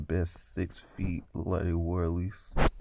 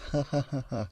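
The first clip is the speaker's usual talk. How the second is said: spaced-out eerie "ha ha ha"; mock laughter; 7 semitones higher, faster than usual. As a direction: imitate a woman's laugh from the dark. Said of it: "we heard laughter".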